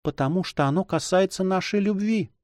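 high, troubled woman's voice; whispering heroine. A narrator's reading. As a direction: confess it in a high voice, not truly whispered. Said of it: "прошептала она".

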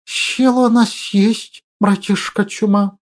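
hissing like a boiling kettle; whistling s. Acted. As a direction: lisp with a whistling hiss. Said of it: "произнёс с присвистом Лихорук, словно закипающий чайник".

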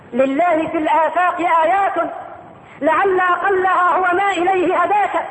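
slow, measured reading of a closing poem verse; poem recitation. Acted.

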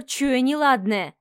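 ordinary delivery, nothing marked

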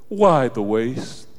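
slow, pious, disapproving; mock the self-righteous tone; sanctimonious Judas. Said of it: "in sanctimonious tones".

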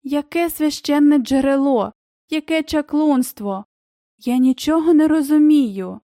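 bewildered protest with a touch of tears; near tears. A narrator's reading. Ellie understands nothing of the sacred spring, nearly in tears. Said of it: "мало не крізь сльози заговорила".

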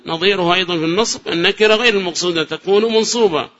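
ordinary delivery

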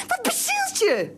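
high, slow exasperated cry. Acted. Wife exasperated, demanding what possessed him. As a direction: throw up your hands in disbelief.

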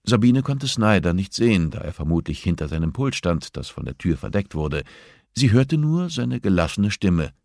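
ordinary delivery

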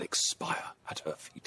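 slow, breathy whisper of a doomed lover; melodramatic whisper. Acted. Jack to his father, acting out his vow, slow whisper. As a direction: let the vow die away to a slow hush.

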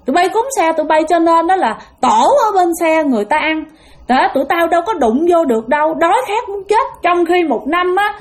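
high, furious ghost voice through the possessed son; angry ghost father. Retold or imitated. Possessed uncle voicing angry dead father.

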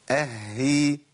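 "أهي" with a long held ه, then long ee; doubled haa with kasra. Shown as a demonstration. Teacher models the next case, haa held. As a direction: linger on the h inside "أهي". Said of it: "الحالة السادسة".

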